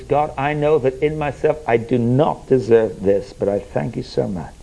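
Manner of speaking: quiet, humble confession to God; prayer. Acted. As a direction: pray it softly, sinking toward the end.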